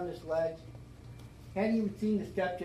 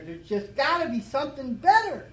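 louder, higher retold plea, punching BETTER; retold cry; 8 semitones higher, 8 dB louder than usual. Drinker crying out to God after fleeing to the bar, emphatic plea. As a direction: cry out for something better, rising in force. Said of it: "I cried out".

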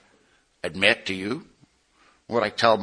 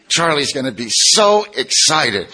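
gushing, slow and loud, higher pitch; acted excitement; 5 semitones higher, 6 dB louder, slower than usual. Edie welcomes Kip at the door, gushing excitement. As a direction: stretch it out in loud, high delight.

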